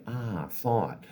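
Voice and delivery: softly said, unhurried acknowledgment; calm noting. Shown as a demonstration. Coach shows how to meet a stray thought, calmly. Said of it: "calmly say".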